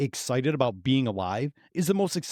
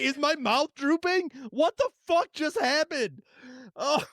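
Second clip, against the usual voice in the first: mock panic; 11 semitones higher than usual. Real, not acted.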